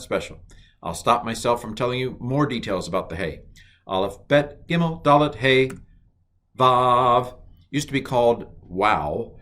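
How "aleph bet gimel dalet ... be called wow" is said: normal talking voice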